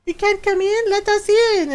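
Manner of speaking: put-on high, whiny-child style pet plea; pleading pets. Pets calling to owner through bathroom door, pleading.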